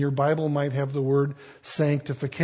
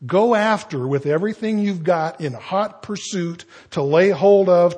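ordinary delivery

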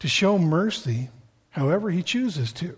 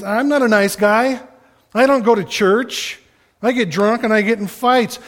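voicing the friend, a bit higher and louder; pleading friend who lost a buddy, told to the congregation, a touch raised; retold friend's words; 6 semitones higher, 5 dB louder than usual. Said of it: "He goes".

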